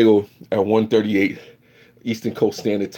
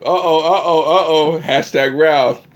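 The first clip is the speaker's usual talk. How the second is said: usual speaking voice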